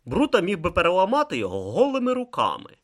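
plain reading voice

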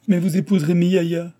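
high, loud acted wail; tearful outburst. A narrator's reading. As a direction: burst out in mock-tearful reproach. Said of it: "riposta la japonaise en éclatant en sanglots". (en éclatant en sanglots, joué)

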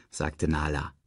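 plain reading voice

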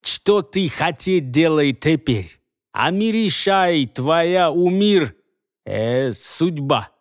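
slow broken-Russian nomad; broken foreign accent. Acted. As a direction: speak like a foreigner, slowly.

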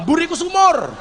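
high, shouted punchline; mock-defiant yell, pitched high; acted comic bravado. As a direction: shout it out like a desperate boast.

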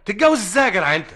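fast, loud, high and laughing; shocked disbelief. Acted. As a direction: blurt it out in amazed disbelief. Shocked at the idea.

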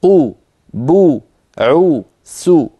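قو بو عو سو slowly with rounded lips; rounded syllable drill. Shown as a demonstration. Teacher models rounded lips for the class. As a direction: round the lips hard on each vowel. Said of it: "مثل قل".

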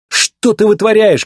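shouted startled question; alarmed cry. A narrator's reading. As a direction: call out in alarm. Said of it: "крикнул Маккуин".

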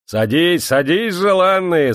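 high, loud eager welcome; hearty host calling guests to sit. A narrator's reading. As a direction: usher dear guests in, rising. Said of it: "сказал цыган".

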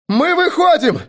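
very high, loud cry of relief; disbelieving shout. A narrator's reading. Driver seeing the end of the fog, shouting. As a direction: shout it, hardly believing it. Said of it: "закричал Грач, едва веря своим глазам".

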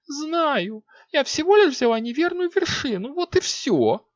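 put-on very high offended girl; sulky young queen defending herself. A narrator's reading. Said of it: "обиделась Виолетта".

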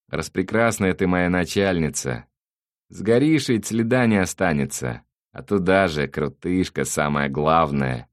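loud, high-pitched sing-out of mockery; theatrical sarcastic taunting; gloating villain. A narrator's reading. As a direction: jeer at a helpless former boss.

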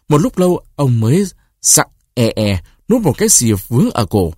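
usual speaking voice

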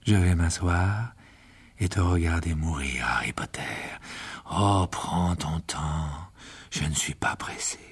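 low, slow menacing whisper; cold villain whisper. A narrator's reading. Riddle to dying Harry, a slow taunting whisper. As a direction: purr the threat, taking his time.

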